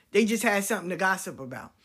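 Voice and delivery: ordinary talk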